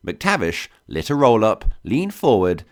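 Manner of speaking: ordinary delivery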